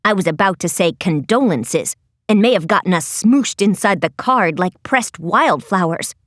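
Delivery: low, quick character voice; low Lizzie voice. A narrator's reading. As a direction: drop the pitch and rattle it off.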